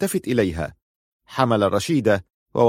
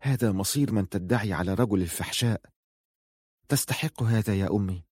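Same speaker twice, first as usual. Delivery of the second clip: hushed, noticeably softer aside; whispering; 6 dB quieter than usual. A narrator's reading. Sayyida murmuring privately to her mother, hushed. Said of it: "ثم همست سيدة لأمها".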